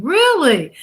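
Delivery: high, loud disbelieving really; sudden surprised question. Real, not acted. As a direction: genuinely caught off guard. Reacting to owner news.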